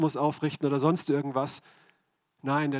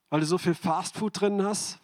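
normal talking voice

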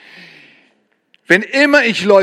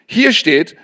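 ordinary talk, nothing marked

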